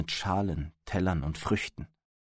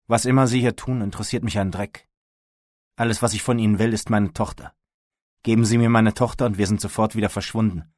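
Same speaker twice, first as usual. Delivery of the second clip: loud, forceful ultimatum; heated, emphatic insistence; angry demand; 2 semitones higher, 7 dB louder than usual. A narrator's reading. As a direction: press the demand hard, voice raised. Armed father demanding his daughter.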